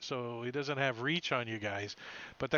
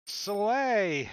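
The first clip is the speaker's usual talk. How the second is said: usual speaking voice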